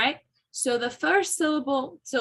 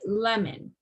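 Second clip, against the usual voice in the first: "Lemon." with LEM stressed and "mon" reduced to a schwa; "Lemon." said as LEM-ən, stress up front. Shown as a demonstration. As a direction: hit LEM clearly, then a falling, weak "mən". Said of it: "You hear the emphasis in the lem part".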